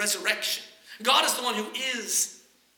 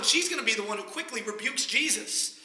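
ordinary delivery

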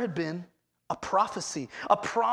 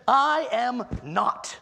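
slow, louder, every word pressed; emphatic denial; 2 semitones higher, 5 dB louder, much slower than usual. Retold or imitated. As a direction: plant your feet on "I am not". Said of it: "So absolutely not".

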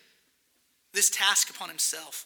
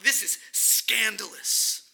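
slowed right down, every word weighed; slow, deliberate; slower than usual. Acted. Preacher repeating to the congregation, slow and deliberate. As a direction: say it slowly and let it land.

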